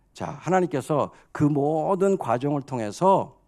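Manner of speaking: ordinary delivery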